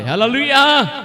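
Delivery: loud, high hallelujah call; preacher's rallying cry; praise shout. Real, not acted. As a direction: ring it out to rouse the congregation. Preacher calls congregation to praise.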